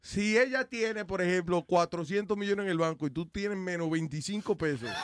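usual speaking voice

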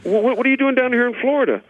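high-pitched, stammering astonishment; amazed disbelief. Acted. Man meeting a talking dog, high amazed disbelief. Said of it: "The guy can't believe it".

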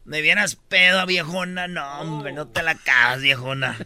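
put-on playful Sinaloan accent, teasing tone. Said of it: "empieza a hablar como sinaloense".

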